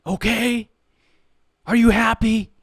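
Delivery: high, loud, bitter shout; teen yelling at his mom in the store; angry yell. Retold or imitated. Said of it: "And he's like".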